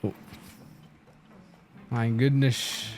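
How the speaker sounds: normal talking voice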